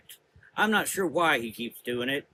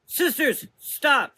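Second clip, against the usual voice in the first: much higher, louder call; 13 semitones higher, 7 dB louder, much slower than usual. A narrator's reading. Prudence calling the fleeing sisters back, loud. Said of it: "she called out".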